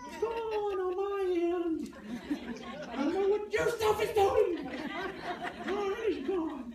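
very high, squealing mock wail; mock panicked outburst. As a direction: squeal it in exaggerated exasperation.

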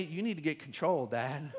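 ordinary delivery

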